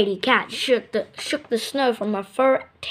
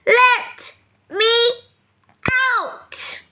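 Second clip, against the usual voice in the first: high, louder cry trailing to a breath; ever so loudly; 10 semitones higher, much slower than usual. Acted. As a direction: call it out, the last word breathed. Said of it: "Then ever so loudly".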